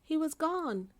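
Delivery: plain reading voice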